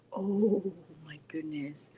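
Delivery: hushed, low whisper of dread. Real, not acted. Reading a fan's gaming question, sheepish whisper. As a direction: whisper it like being caught out.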